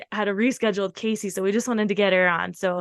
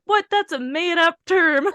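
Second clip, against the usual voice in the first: high-pitched mock incredulous friend; imitated disbelief; 8 semitones higher than usual. Friend scoffing at an unfamiliar word, mock disbelief.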